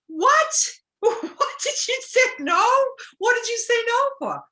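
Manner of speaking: very high, loud shocked disbelief with a laugh. Retold or imitated. Sponsor hears newcomer turned down speaking, shocked. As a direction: react in total disbelief. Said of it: "she was just like".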